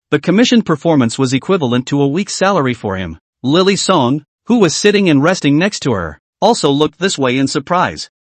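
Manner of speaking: ordinary delivery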